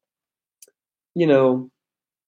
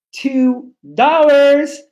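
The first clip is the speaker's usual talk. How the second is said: excited; 8 semitones higher, 4 dB louder, much slower than usual. Real, not acted. Reacting to donation amount.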